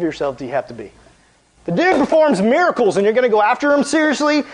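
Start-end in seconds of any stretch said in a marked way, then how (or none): 1.6-4.4 higher, louder mock disbelief; incredulous scoff. Youth preacher scoffing at the Pharisees, incredulous. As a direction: scoff at the nerve of it. Mocking the people's arrogance.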